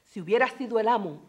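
dramatic reading of Spanish verse with long pauses; Spanish poem recitation. Acted. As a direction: declaim it like a proud lament.

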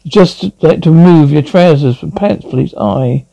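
normal talking voice

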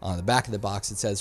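ordinary talk, nothing marked

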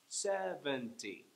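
Seventy slow, stepping down syllable by syllable; walk Seventy down a staircase, slowly; slow descending steps. Shown as a demonstration. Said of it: "imagine a descending staircase".

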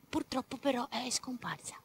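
unvoiced sad whisper; whispered regret. A narrator's reading. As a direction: breathe it out like bad news.

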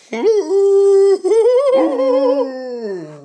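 a loud, high burst of laughter. Real, not acted. Owner laughing loudly and high at her dog. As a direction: let a high laugh ring out.

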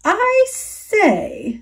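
a tiny voice calling up; small spider voice. Acted. Tree spider greeting the bug, small high voice. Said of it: "the small voice of a tree spider".

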